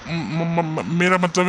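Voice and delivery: flustered stammer. Acted. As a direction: stutter in disbelief. Stunned man stammers at wish offer. (हैरानी से हकलाते हुए)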